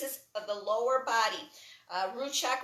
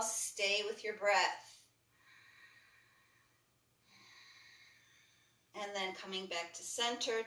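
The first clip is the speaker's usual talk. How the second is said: calm yoga instructor leading a class; guided yoga cueing. Acted. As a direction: talk them through each stretch gently. Guides a breathing yoga sequence.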